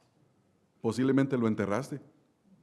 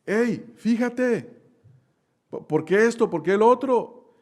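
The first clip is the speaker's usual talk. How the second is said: a higher, mildly indignant driver's gripe; mock complaining; 6 semitones higher, slower than usual. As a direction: grumble at the other driver, half in jest. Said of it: "llegar a quejarse y decir". (queja de conductor, en tono de burla)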